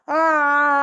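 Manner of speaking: loud high open "ah"; lion roar. Shown as a demonstration.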